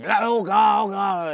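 high, loud churchy wailing; mock church folk. Mocking church folk who invoke God, wailing. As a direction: ham up a fervent believer.

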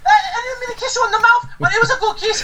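giddy high character voice. Acted. [name] keeps character.

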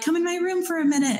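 put-on high pleading child's voice; kid begging. Kids asking dad into their room, in a high child's voice. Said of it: "the kids are like".

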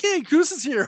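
high, loud gleeful yay; squealing with delight; excited cheer. Retold or imitated. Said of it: "and yet I was like".

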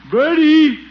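loud, high call across the house. Acted. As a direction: call her from the next room. Calling maid from another room.

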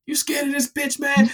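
mock him like a schoolyard bully; high mocking taunt. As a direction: brother jeering at his scared sibling, high mock voice. Brother taunts the other.

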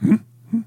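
two short questioning grunts; Yoda harumph. Shown as a demonstration. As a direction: warm up the impression with little "hmm" noises. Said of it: "like, my entry sound".

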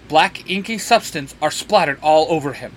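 usual speaking voice

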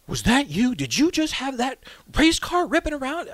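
high, lightly voiced disbelieving officer; incredulous cop. Officer questions teacher.

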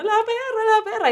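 high-pitched alarm, no extra volume; retold panic. Owner rushing to save the dog, high panicked cry. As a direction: squeal it in mock alarm.

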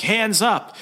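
high-pitched mock cop command; mock police order. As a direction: order a suspect like a jumpy cop. Cops ordering suspect at gunpoint.

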